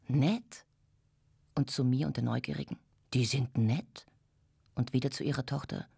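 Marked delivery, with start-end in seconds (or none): 0.1-0.6 sharply rising, scornful question; incredulous echo. A narrator's reading. Mother scornfully echoes girl's word. 3.1-4.1 mocking question with a sharp final rise; incredulous scorn. A narrator's reading. Mother repeats it to onlookers scornfully.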